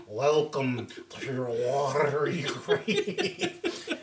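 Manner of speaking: very slow, drawn-out mock-eerie welcome with laughter; exaggerated elongated spooky greeting; rolled-off delivery. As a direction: roll and stretch every word for comic effect. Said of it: "you gotta roll that off".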